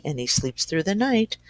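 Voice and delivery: ordinary delivery, nothing marked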